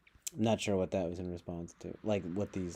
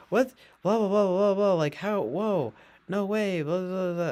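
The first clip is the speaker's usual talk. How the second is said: high, loud mocking blather; hammed-up scandalized babble; mock outrage; 11 semitones higher, 10 dB louder than usual. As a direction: imitate shocked complainers, loud and overblown. Mocking the backlash to the film.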